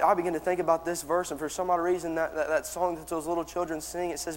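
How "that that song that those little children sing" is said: ordinary talk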